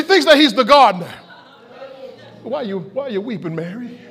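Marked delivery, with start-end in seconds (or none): 2.4-4.0 much softer, low and tender; gentle Jesus. Acted. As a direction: hush down and comfort her. Jesus gently speaking to Mary.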